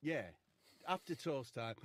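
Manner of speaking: normal talking voice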